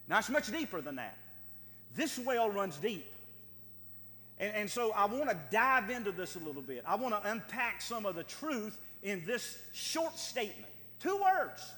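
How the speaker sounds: usual speaking voice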